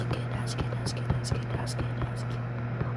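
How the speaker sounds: breathy whisper to himself. Real, not acted. Gamer whispering relief to himself as the fight turns. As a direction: whisper it under your breath. Muttering to self unvoiced.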